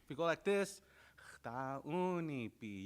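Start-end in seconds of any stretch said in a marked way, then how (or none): 1.4-2.8 "Chtaunipi" stretched very slowly syllable by syllable; slow drawn-out Dakota word. Shown as a demonstration. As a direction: say "Chtaunipi" slowly to hear yourself. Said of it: "you could hear yourself say it better".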